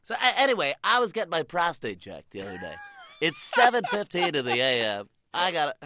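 high, loud, brash DJ voice; brash morning DJ trying to shock; shock jock. Retold or imitated. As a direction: overshare to shock the listeners. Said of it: "They're like".

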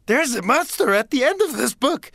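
high, fuzzy Muppet warning; Grover voice. Retold or imitated. Grover warns the reader about the monster, high and anxious. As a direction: warn like a frightened Grover. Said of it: "is the Grover book".

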